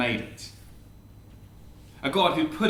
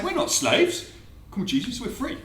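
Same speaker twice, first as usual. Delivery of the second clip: high-pitched affronted protest; offended; 8 semitones higher than usual. Acted. Listeners objecting to what Jesus said, offended. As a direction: protest as if mildly insulted. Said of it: "are a bit offended".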